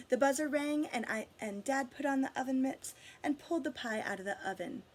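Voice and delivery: plain reading voice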